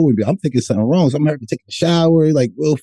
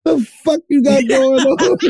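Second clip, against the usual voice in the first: accusing rant; 11 semitones higher than usual. Retold or imitated. Man accusing the girl he slept with, high laughing rant. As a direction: throw the blame at her, half laughing.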